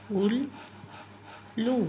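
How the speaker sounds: उल्लू said very slowly with a long stretched ऊ; drawn-out oo. Shown as a demonstration. Teacher to class, slowly stretching उल्लू. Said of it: "ऊ की मात्रा जो ज़्यादा स्ट्रेस के साथ".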